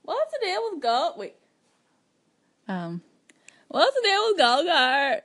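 high, fast Seinfeld with Irish lilt; Irish Jerry Seinfeld. Shown as a demonstration. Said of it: "like Irish Jerry Seinfeld".

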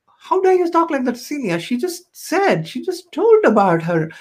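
indignant scolding. Retold or imitated. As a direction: imitate women jumping on someone in reproach. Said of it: "they all jumped on her".

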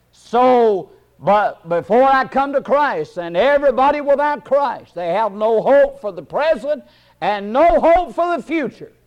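emphatic sermon cadence; preaching. Real, not acted. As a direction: preach it with rising fervor. Preaches with rising force.

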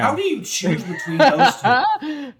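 high-pitched question through laughter; laughing. Real, not acted. Laughing at the dilemma.

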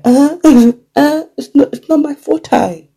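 high-pitched mock African accent. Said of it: "That's my little African voice there".

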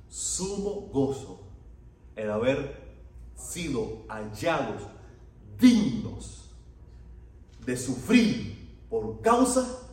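very slow, each key word punched; emphatic declaiming. Real, not acted. Preacher declaims the apostles' joy in suffering, slow and emphatic. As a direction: hammer "sumo", "dignos" and "causa", with long pauses between.

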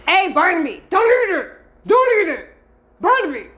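high, loud shouts to stop the cat; calling out to the cat. Real, not acted. Owner shouts at cat Barnaby not to eat plant, high and loud.

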